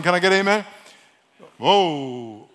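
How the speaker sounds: normal talking voice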